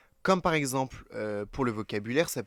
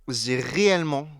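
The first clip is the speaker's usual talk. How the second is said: réellement appuyé, plus fort; insistance sur réellement; 7 dB louder than usual. Real, not acted. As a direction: appuie bien sur réellement. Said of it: "je dis bien réellement". (accent d'insistance sur réellement)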